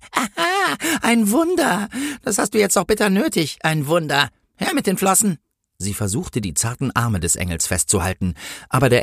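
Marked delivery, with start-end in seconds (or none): 0.1-5.4 high-pitched gloating mockery; spiteful policewoman. A narrator's reading. Policewoman mocks the promised miracle, high and spiteful. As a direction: jeer in a high voice. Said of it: "lachte die Politesse gehässig".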